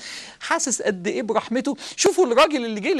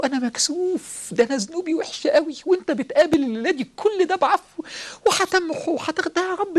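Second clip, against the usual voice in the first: higher pleading voice, rising emotion; ashamed prayer; 7 semitones higher than usual. Acted. Worshipper confessing shame to God at night, higher and pleading. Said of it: "وتقول له".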